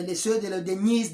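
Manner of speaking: ordinary delivery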